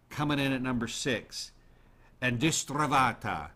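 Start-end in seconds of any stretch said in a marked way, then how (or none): 2.2-3.5 careful, louder Italian-sounding reading of the Spanish name; Italian-ish accent. Shown as a demonstration. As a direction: roll it out like an Italian. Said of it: "I kind of sounded Italian doing that".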